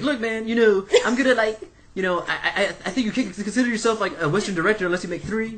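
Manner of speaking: high-pitched, excitable film director impression; Quentin Tarantino. Film director answering a question about Westerns, high-pitched impression. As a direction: imitate a director talking himself up, pitched high. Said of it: "in the panel, he's like".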